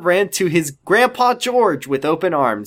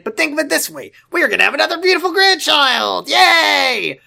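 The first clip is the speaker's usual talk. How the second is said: excited; 13 semitones higher, 3 dB louder than usual. Acted. Dad cheering over grandchild.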